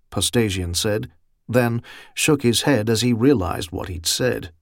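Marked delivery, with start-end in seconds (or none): none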